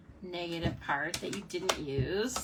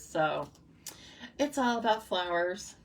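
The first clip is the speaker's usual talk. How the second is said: usual speaking voice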